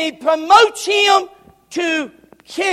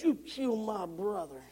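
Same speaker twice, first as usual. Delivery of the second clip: very soft low murmured revenge; vengeful hush; 7 semitones lower, 17 dB quieter than usual. Acted. Joab stabbing Abner, soft low vengeance. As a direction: whisper it as you strike.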